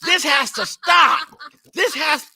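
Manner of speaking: high, loud, slow laughing groan; laughing exasperation. Real, not acted. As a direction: laugh in mortified disbelief. Mortified laughing at gift.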